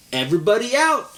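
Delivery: calling out. Acted. Valerie calling the class outside, loud call. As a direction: shout it across the playground.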